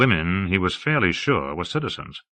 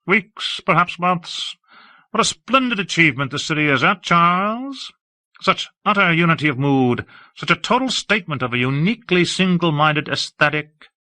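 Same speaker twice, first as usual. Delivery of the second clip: higher, affected aesthete voice; a lofty, admiring high voice; Hawke, airy and effusive; 9 semitones higher than usual. Acted. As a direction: gush about the city grandly.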